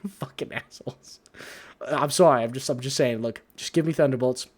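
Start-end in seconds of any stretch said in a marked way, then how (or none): 0.1-1.2 breathy laughing whisper of an insult; muttered aside. Real, not acted. Laughing aside after rant.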